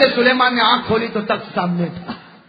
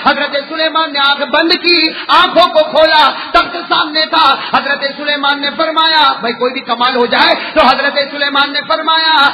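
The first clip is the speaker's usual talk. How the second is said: raised, steady sermon delivery; full-voiced preaching; 7 semitones higher, 8 dB louder than usual. Preacher retelling the throne's arrival, full-voiced preaching. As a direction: project it to the whole congregation.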